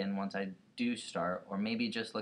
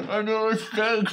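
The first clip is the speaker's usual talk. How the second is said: laughing; 12 semitones higher, 9 dB louder than usual. Real, not acted. Laughing fit at start.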